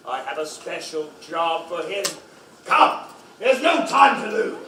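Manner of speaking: ordinary talk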